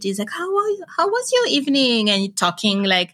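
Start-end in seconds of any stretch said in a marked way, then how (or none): 0.3-2.1 imitating a sugary boss, pitched much higher; mock-sweet, overly friendly greeting; super sweet boss. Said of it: "super sweet".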